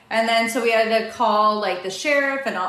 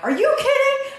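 shrieked much higher, laughing incredulous squeal; outraged disbelief; 4 dB louder than usual. Retold or imitated. Wife retells refusing to let the robber keep her bags, laughing shriek.

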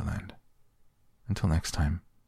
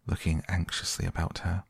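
usual speaking voice

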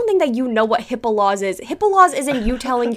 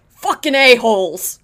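mock yell; 4 dB louder than usual. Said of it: "and then he just screams".

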